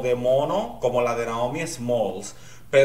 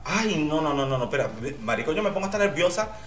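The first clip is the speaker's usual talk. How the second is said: normal talking voice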